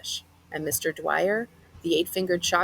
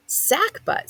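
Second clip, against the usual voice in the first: high mocking repeat; 10 semitones higher, 3 dB louder than usual. Boy mocking the odd word.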